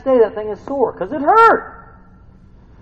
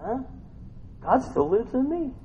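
usual speaking voice

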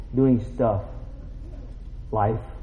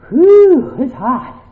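acted overheated sigh; 11 semitones higher, 7 dB louder, much slower than usual. Acts out working in scorching sun.

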